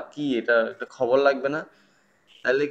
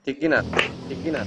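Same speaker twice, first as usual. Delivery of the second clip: doubled by an open mic; words bounce back as an echo; faster than usual. Real, not acted. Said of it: "sir, যে প্রতিধ্বনি হচ্ছে".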